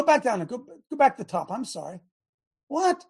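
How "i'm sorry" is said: normal talking voice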